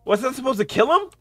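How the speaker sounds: high, loud baffled outburst; exasperated disbelief. Real, not acted. Player baffled by a game task, exasperated disbelief.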